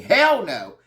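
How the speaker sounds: loud, high mock yell of hell no; hammed-up screaming hell no; imitated screaming. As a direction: shout it after her like an outraged castmate. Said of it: "she's just screaming".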